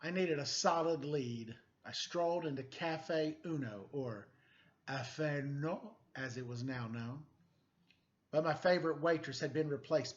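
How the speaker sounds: animated picture book reading; story narration. A narrator's reading. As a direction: read it like a noir storybook to kids. Reads picture book aloud.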